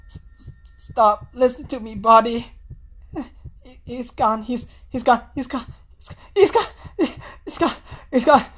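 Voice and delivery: put-on high, stammering panicked girl repeating herself; a frightened girl clinging to the words; panicking girl. Senri voiced panicking.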